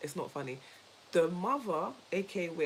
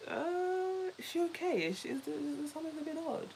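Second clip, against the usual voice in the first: higher, faster suspicious aside; mock doubting onlooker; 10 semitones higher than usual. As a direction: lightly mimic a puzzled stranger. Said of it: "They'll be thinking".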